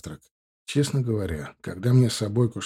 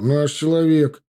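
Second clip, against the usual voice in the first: raised, drawn-out approval; mildly hearty exclamation; exclaiming; 5 semitones higher, 4 dB louder, slower than usual. A narrator's reading. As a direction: cheer him on slowly, pitched up. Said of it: "воскликнул Тимур".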